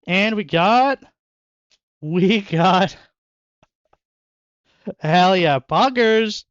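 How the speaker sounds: hyped, loud and high; cheering a great pull; excited. Real, not acted. Hyped over a good card pull.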